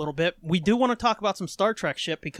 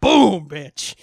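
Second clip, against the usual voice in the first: triumphant; 2 semitones lower, 7 dB louder than usual. Real, not acted. Triumphant brag.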